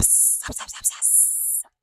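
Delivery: radiator hiss. Retold or imitated. Mimicking her smoking car's hiss for viewers, whispered sops. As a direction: hiss like steam escaping.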